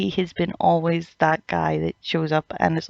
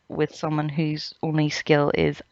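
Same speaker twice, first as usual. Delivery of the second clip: usual speaking voice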